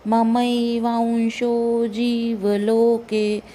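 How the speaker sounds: slow, clear ममैवांशो जीवलोके; slow recitation. Shown as a demonstration. Teacher demonstrating the corrected phrase, slowly. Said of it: "व का उच्चारण आना चाहिए".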